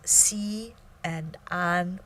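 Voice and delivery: "Xi" and "An" whispered slowly, kept apart; separated syllables. Shown as a demonstration. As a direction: breathe out "Xi ... An" slowly, two beats. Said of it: "that is two syllables".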